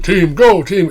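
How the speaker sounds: high, loud little rabbit cheering; rabbit cheerleader. Retold or imitated. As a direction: play a tiny rabbit rallying its team. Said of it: "one rabbit going".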